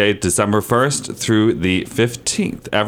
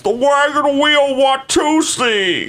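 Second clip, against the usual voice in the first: high slow campy voice; slower than usual. Pretend. Camp dance announcement.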